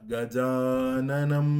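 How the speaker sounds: গজাননম very slow and loud, each syllable given its length; long and short syllables. Shown as a demonstration. Teacher showing syllable length in গজাননম, slow and loud. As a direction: stretch গজাননম, long vowels held. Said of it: "যাতে দুই মাত্রা".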